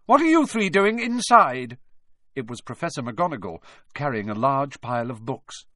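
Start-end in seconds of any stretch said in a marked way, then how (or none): 0.0-1.8 high, loud challenge across a hall; ringing voice. A narrator's reading. McGonagall catches three pupils indoors, ringing challenge. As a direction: ring it out sharply. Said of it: "a voice suddenly rang across the hall".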